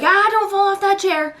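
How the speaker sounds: put-on high, quick alarmed call to the toddler; edgy warning. Mom calling out to her toddler near a cabinet, high and edgy. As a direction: snap out a nervous warning.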